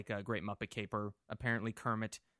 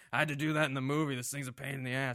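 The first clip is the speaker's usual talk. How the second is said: retold grumbling; 4 semitones higher, 4 dB louder, faster than usual. Retold guest grumbling about a scene.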